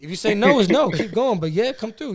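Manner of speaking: high, loud and blunt; direct Jersey way. Shown as a demonstration. Showing friends how Jersey talks, loud and blunt. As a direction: lay it down straight, no room for no.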